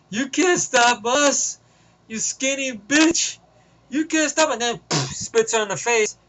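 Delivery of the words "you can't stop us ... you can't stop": higher, slow sneering taunt; smartass taunt. Retold or imitated. Captured villain taunting the heroine, slow sneering. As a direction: drag it out, mocking her. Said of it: "being a smartass".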